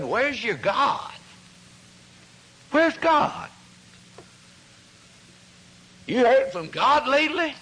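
high, mocking taunt that climbs as it goes; a scoffer mocking the troubled leader. Scoffing man taunts David.

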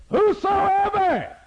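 high, slow, stretched shout; drawn-out preaching cry. Real, not acted. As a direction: stretch the word over the crowd.

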